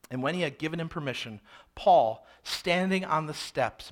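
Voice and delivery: usual speaking voice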